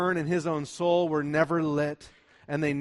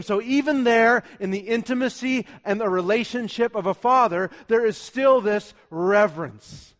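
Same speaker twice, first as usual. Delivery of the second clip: high, loud, emphatic pulpit voice; forceful preaching; 6 semitones higher, 7 dB louder than usual. Real, not acted. Preacher telling church how Moses approaches God, forceful preaching. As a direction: drive each point home to the congregation.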